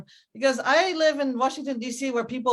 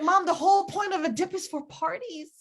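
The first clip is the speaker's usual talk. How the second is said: daughter's protest; 8 semitones higher than usual. Retold or imitated. Daughter protesting to mother in retold scene.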